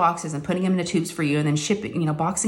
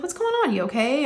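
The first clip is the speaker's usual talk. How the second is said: surprised concern; 9 semitones higher than usual. Retold or imitated. Mom answering daughter's call.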